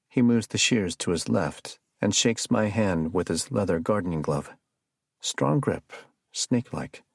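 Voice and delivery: ordinary delivery, nothing marked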